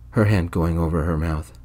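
plain reading voice

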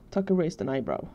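ordinary delivery, nothing marked

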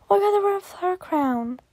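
usual speaking voice

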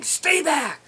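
high loud angry warning; furious bull telling rivals to stay back. Pretend. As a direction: bark it like a ticked-off bull elk. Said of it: "You notice the anger and the frustration".

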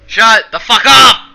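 loud, slow yelled outburst; furious shout. Acted. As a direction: scream it at the pest. Screamed reply to own put-on voice.

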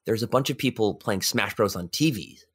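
ordinary delivery

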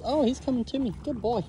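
normal talking voice

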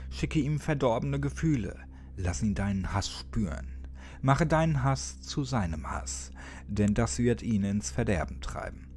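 usual speaking voice

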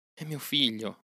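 a mother claiming her son proudly; first of two loving insistences; fond insistence. Acted.

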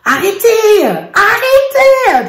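high, drawn-out mock child yelling; a kid's exaggerated protest; imitating a child's tantrum. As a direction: whine it like an upset little boy. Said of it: "au début, il dit".

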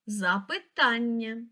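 Запитання said slowly, stretched on тан; stress on third syllable. Shown as a demonstration. Teacher repeating the dictionary word, third syllable of Запитання stressed. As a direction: draw out the third syllable, za-py-TAN-nya, in Запитання. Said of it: "Наголос на третій склад".

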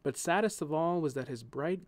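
ordinary delivery, nothing marked

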